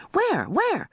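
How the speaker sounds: high-pitched searching call; shouting. A narrator's reading. Mother hunting for the phone, high questioning shout. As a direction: call out through the house. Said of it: "wandered through the house shouting".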